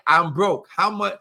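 usual speaking voice

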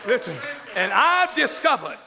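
fiery sermon shout; preaching. Real, not acted. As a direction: preach it out full force. Preaching at full force.

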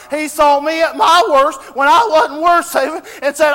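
shouted, high preacher's cry; fervent revival preaching; preaching at full force. Acted. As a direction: preach it to the back row, full voice. Preaching Christ's sacrifice.

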